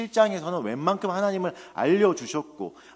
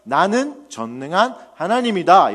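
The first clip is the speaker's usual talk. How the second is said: loud, slow, higher proclamation; God rebuking; 4 semitones higher, 9 dB louder, slower than usual. Retold or imitated. God breaking thirteen years of silence, loud rebuke. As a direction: declare it with full divine authority. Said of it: "책망하십니다".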